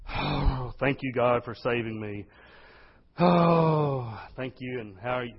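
heavy sighing, listless thanks; funeral-like thanks. Acted. Glum worshipper thanking God at church, funeral-like. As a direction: sigh out gratitude with no joy in it. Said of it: "we look like we're at a funeral".

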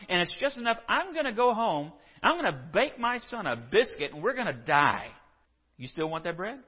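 high, loud, incredulous complaint; exasperated widow. Retold or imitated. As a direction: sound fed up and disbelieving. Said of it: "she turns to him and it's like".

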